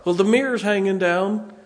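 sheepish high wife; wife's voice. Retold or imitated. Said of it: "how bad's the car?".

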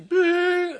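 put-on high, loud wailing blubber of a little girl; imitating daughter crying. Dad imitating his daughter bursting into tears, high wail. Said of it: "she was crying".

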